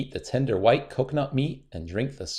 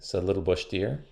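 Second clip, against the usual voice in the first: plain reading voice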